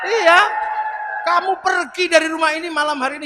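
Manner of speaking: hammed-up husband's command; mock bossy shout. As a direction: order her out with bravado. Telling wife to leave the house.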